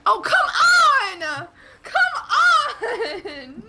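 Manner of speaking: high, drawn-out wail of protest; exasperated outcry. Real, not acted. Player protesting at the table, exasperated high wail.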